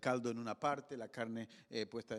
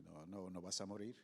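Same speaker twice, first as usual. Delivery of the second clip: tranquilizador; 5 semitones lower, 10 dB quieter than usual. Acted. El Señor calma a Gedeón.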